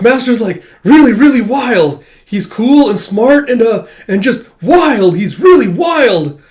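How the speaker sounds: high, loud and slowing, losing his words; fanboy over your hero; gushing. Retold or imitated. Tama gushing about his master to Akane, high and loud.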